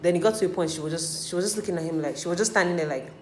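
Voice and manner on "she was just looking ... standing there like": normal talking voice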